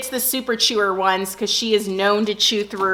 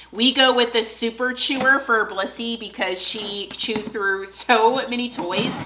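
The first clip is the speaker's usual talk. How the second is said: normal talking voice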